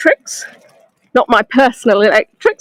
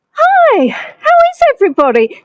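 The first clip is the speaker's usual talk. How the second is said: bright high greeting; 13 semitones higher than usual. Real, not acted. Live tour guide greeting her viewers, high and cheery. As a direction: pitch jumps up for a cheery hi.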